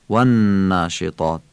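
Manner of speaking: والناشطات with the نون held and nasal; held doubled n. Shown as a demonstration. Teacher demonstrating ghunna to reciters. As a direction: hum and stretch the نون of والناشطات. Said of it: "نون والناشطات هم مشدده و غنه داره".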